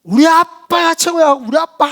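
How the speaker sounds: high, loud, drawn-out cheer; proud child boast. Acted. Son bragging about his dad to others, proud child boast. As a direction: crow it like a kid showing off.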